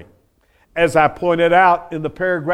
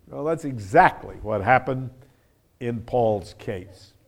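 usual speaking voice

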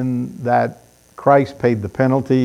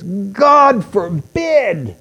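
King James solemnity; 5 semitones higher, much slower than usual. Shown as a demonstration. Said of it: "the old King James Version translates this".

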